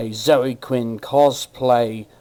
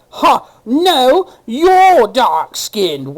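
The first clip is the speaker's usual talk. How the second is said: much higher, loud, slow whiny mimicry; mocking retort; 9 semitones higher, 7 dB louder, much slower than usual. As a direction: drag it out like a petulant comeback. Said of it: "you responded to the ... with".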